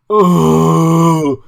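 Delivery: long, loud, high "oh"; imitated groan of dawning defeat; mock anguished wail. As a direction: wail as the awful truth lands. Imitating loser realizing his defeat.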